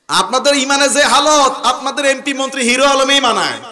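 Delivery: high-pitched rebuke from the pulpit; fiery preaching. Real, not acted. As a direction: preach it out to a big crowd. Preaches at full force.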